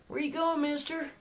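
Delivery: high, friendly calling out from the car; Becky's voice. Retold or imitated. Woman to roadside hitchhiker through window, high and inviting. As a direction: pitch it up and sing it out to the stranger.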